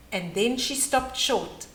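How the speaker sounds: usual speaking voice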